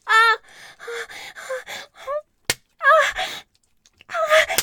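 high, loud climactic cries; rising cries of release. Acted. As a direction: let out sharp loud cries.

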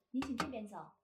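ordinary talk

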